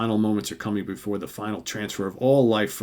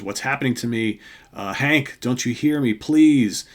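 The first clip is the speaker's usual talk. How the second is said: acted frantic plea; 3 semitones higher, 5 dB louder than usual. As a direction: plead with mounting mock distress. Voicing Janet waking and pleading.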